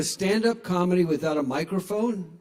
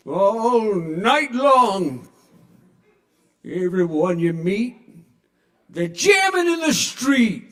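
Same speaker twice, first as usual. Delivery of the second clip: put-on slow, higher, louder grumbling old man singing; grouchy old man; 3 semitones higher, 6 dB louder, much slower than usual. Shown as a demonstration. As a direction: drag it out like a cranky grandpa. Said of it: "if the narrator was a grouchy old man".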